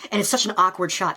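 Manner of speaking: normal talking voice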